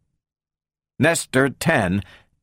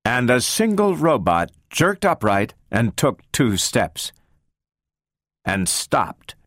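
ordinary delivery

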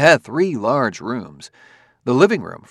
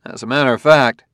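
quick, louder interjection; 3 semitones higher, 7 dB louder than usual. A narrator's reading. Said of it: "said Bailey quickly".

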